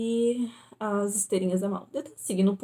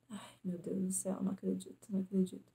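soft, defeated disbelief; hushed despair; 9 dB quieter than usual. Retold or imitated. Traveler at the closed gate, soft despair. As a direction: let it fall away softly.

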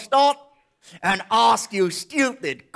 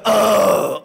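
loud, held "oh"; demonstration cry; 6 dB louder than usual. Shown as a demonstration. Comic coaches the audience to scream, loud cry. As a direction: show the crowd a big sustained oh. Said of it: "I need all of you to go".

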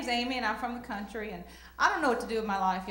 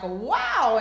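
wow; 3 semitones higher, 8 dB louder than usual. Retold or imitated. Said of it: "And I'm like".

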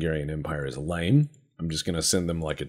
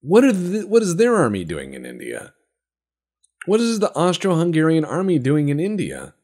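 higher and louder, baffled disbelief; incredulous outburst; 11 semitones higher, 7 dB louder than usual. Real, not acted. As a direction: cry out in real disbelief. Shocked by enemy army in India.

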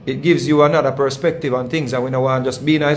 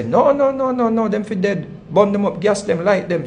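mimicked woman's voice, much higher; imitating frantic woman; 7 semitones higher than usual. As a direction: squeal it like the agitated woman. Retold woman demanding violence.

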